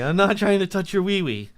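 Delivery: high, loud mock protest; pleading man impression. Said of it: "that's what he said to me".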